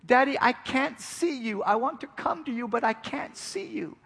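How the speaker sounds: put-on high, loud little girl calling, close to tears; a scared five-year-old shouting for her dad; quivering child shout. Lost daughter shouts for her father with a quiver, high and loud. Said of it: "with a quiver in her voice, she shouted".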